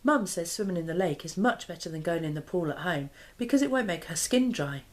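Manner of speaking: plain reading voice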